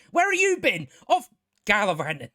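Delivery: ranting at a TV character, exasperated shout. Real, not acted. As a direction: yell it at the screen in disbelief.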